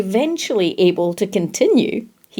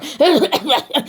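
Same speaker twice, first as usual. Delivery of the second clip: spluttering cough; throat-clearing splutter; 5 semitones higher than usual. A narrator's reading. The flung spider making himself heard, throat-clearing splutter. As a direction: clear the throat in a little sputter. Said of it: "familiar throat-clearing splutter".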